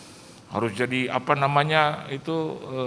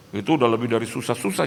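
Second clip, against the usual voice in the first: ordinary talk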